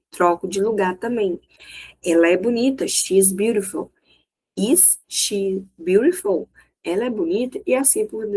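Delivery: normal talking voice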